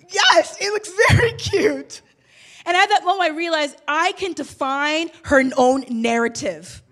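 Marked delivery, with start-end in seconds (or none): 0.1-2.0 high, loud, bright through laughter; laughing agreement. Retold or imitated. Mom agreeing with daughter about her swirly haircut, laughing. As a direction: laugh it out in delight.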